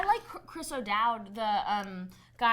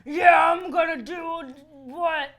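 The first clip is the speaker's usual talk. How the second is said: loud, higher, slow mock threat; hammed-up shout; 6 semitones higher, 10 dB louder, much slower than usual. Friends spoofing the film Taken, hammed-up shout. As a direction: drag it out in a hammed-up yell.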